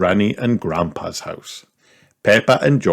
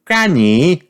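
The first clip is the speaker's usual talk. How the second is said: put-on little girl voice; 10 semitones higher, 5 dB louder than usual. A narrator's reading. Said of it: "said Peppa".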